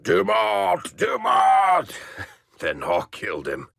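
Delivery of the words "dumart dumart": high, hammed-up monster yell of the name; mock darkspawn bellow. Mocking a darkspawn for friends, hammed-up bellow. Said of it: "I'm a darkspawn".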